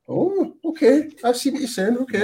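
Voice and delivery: retelling a reaction to the coach's speech, mock surprised; mock surprise. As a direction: draw it out like someone caught off guard, pitched high.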